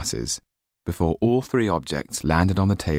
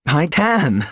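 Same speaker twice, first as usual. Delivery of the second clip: put-on a lightly raised young man's voice, happy; Jan's voice, laughing; 10 semitones higher, 4 dB louder than usual. Jan answers Tetu proudly, with a light laugh. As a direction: toss it off with a laugh.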